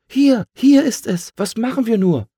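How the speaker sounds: very high, loud alarmed little bird; frantic sparrow raising the alarm; agitated sparrow. A narrator's reading. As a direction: squeal it in a panic. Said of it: "Aufgeregt sprang er hoch".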